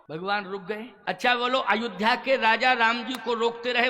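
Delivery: ordinary delivery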